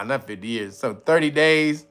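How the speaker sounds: usual speaking voice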